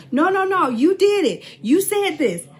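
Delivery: high, loud mock insistence; insistent accuser. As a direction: refuse to hear any explanation. Acted argument, accusing voice.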